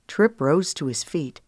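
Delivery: ordinary delivery, nothing marked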